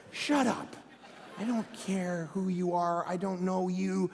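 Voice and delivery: low, unbothered brush-off; dismissive retort. Acted. Pastor answering an online critic, dismissive. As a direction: wave off a stranger's complaint.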